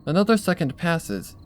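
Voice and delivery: usual speaking voice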